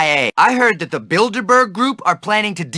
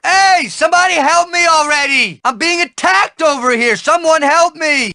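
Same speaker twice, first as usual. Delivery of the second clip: calling for help; 6 semitones higher than usual. Acted. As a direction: yell for anyone to come help. Being attacked, calling for help.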